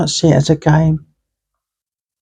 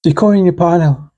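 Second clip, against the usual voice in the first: usual speaking voice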